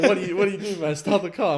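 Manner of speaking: loud, high shouting at the driver; panicked yell. Retold or imitated. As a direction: yell start the car in panic.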